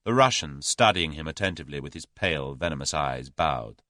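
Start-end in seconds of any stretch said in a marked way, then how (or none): none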